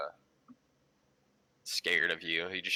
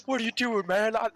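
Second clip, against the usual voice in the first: shouting; 7 dB louder than usual. Acted. Barkeeper yelling at destruction.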